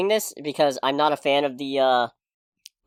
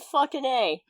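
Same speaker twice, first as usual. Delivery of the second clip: normal talking voice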